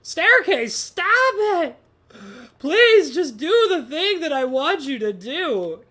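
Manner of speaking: loud, high exasperated begging; frustrated cry to an unruly object; exasperated pleading. Real, not acted. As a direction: scold it at the top of your voice, pleading. Scolding a misbehaving game staircase.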